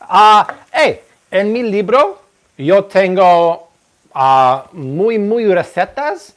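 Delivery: hammy gringo Spanish, words slowed down; mock Mexican-Spanish accent, half-joking; playful Spanglish. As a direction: show off broken Spanish for fun. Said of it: "People love my Spanglish".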